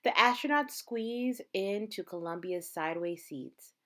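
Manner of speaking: plain reading voice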